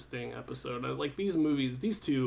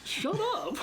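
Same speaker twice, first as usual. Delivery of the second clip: very high, laughing squeal of dismissal; mock exasperation. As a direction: squeak it out as a joking retort. Retold argument, echoing co-host's retort.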